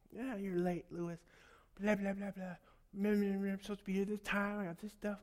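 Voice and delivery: high, mocking voice of a yelling customer; mock angry customer. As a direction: ham up the rude guy berating dad. Angry customer cussing dad out.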